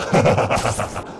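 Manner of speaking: raspy, gloating chuckle; villain chuckle. Acted. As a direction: chuckle like a mocking monster. Villain mocks the hero.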